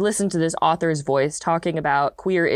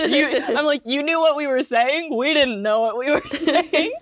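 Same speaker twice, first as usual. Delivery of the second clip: laughing; 8 semitones higher than usual. Retold or imitated. Retelling own reply, laughing.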